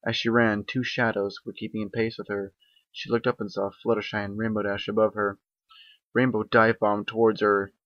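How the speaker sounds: usual speaking voice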